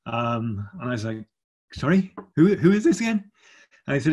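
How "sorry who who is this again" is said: baffled. Retold or imitated. Baffled by palace phone call.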